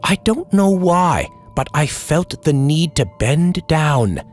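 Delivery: ordinary delivery, nothing marked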